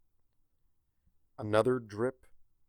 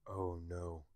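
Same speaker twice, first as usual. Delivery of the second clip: low, hushed dread; quiet dread; 5 semitones lower, 9 dB quieter than usual. A narrator's reading. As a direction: mutter it, dreading what comes. Celestia feels a sneeze coming.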